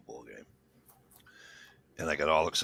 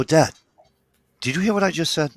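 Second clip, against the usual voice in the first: raised, loud retold protest to a parent; exasperated appeal; 9 semitones higher, 6 dB louder than usual. Son interrupting dad's long lecture, exasperated appeal. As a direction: cut in insistently, pitched up.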